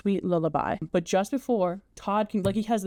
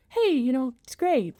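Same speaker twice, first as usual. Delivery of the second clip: high, breezy mock-cheerful cut-in; chirpy interruption; 6 semitones higher than usual. Mrs Lovett interrupting Todd's rant, chirpy high cut-in. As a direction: breeze in sweetly before the bad news. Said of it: "she goes".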